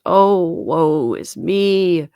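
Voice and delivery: slow, loud mournful wail; wailing lament. A narrator's reading. Ghost wails its doom, slow and loud. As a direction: drag out the woe.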